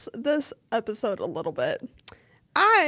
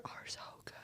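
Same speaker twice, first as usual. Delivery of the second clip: whispering; 22 dB quieter, faster than usual. Real, not acted. Gushing about favorite songs.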